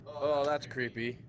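normal talking voice

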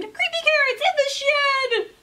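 high, loud, slow frightened yell; child screaming in fright; panicked scream. Acted. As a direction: shriek the warning in terror. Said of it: "Jasper screamed".

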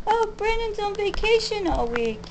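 high, sing-along mock chirp; mocking mimicry. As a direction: parrot the boast in a high voice. Mimics the woman's vacation boast.

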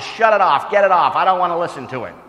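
loud, high angry order; shouting uncle, fast at the end. Retold or imitated. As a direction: bark at him to shut it off. Uncle angry in retold quarrel.

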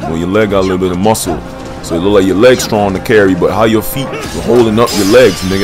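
usual speaking voice

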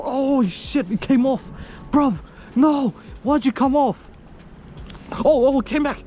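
real frantic joy, voice jumping up; excited yelling. Real, not acted. Angler losing then regaining the fish, frantic excited yells.

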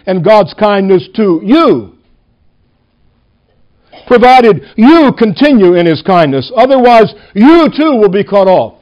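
every YOU held and punched; stress on YOU. Shown as a demonstration. Preacher warning believers from the verse, stress on YOU. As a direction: read out the warning, landing hard on each "you". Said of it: "I'm going to emphasize the word you".

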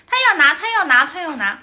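loud, high insistent repetition; the mother insisting in the quarrel; imitating the other mother. 对方妈妈吵架时回答. (模仿对方妈妈大声强辩)

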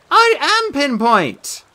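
high, very slow insistent voice; arguing Beckett. A narrator's reading. Beckett argues with his brother, high and drawn out. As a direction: insist like a stubborn big kid. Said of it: "argued Beckett".

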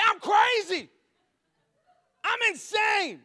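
high-pitched, hammed-up madman yell; crazed shout. Homeowner charging into the yard, crazed shout. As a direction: yell like you've comically lost it. Bursts out waving gun.

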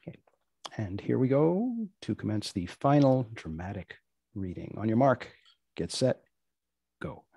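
ordinary delivery

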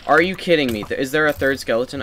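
loud, high exasperated outburst; raging gamer. Real, not acted. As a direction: explode in disbelief at the game. Third skeleton shows up.

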